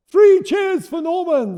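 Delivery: high, loud, slow cheering cry; rally the crowd in a slow cheer. Acted. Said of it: "the dragons cried".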